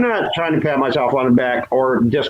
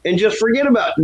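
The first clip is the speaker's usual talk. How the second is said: mock begging; 7 semitones higher than usual. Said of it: "and I'm begging him".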